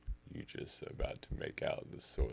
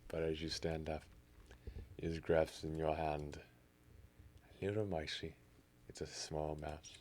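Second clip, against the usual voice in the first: normal talking voice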